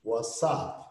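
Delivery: WhatsApp said as "wassap", no t; reduced pronunciation. Shown as a demonstration. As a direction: drop the t in WhatsApp: "wassáp". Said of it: "ela pode ser pronunciada".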